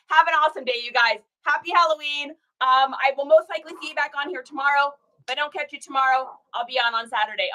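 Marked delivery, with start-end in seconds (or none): none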